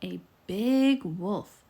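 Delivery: usual speaking voice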